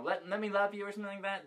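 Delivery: quiet, fast, trailing mumble; unsure mumble. Real, not acted. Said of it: "you don't even know the name".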